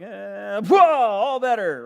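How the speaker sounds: high, loud, slow hammed-up wave-away; Naaman's fantasy of a lazy magic cure, comically loud; mock magic healer. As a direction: ham up a careless miracle flourish. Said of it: "wanted Elisha to just be like".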